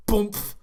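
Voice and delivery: loud, high comic impact noise; imitated thump. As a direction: punch out a cartoonish whack sound. Imitates the tail's knockout blow.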